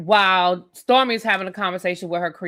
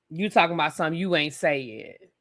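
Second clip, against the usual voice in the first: normal talking voice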